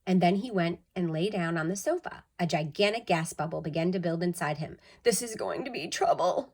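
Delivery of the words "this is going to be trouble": worried Walter. A narrator's reading. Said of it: "he said to himself".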